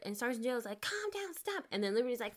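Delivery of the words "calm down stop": panicked. Retold or imitated. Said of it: "they're so fucking scared".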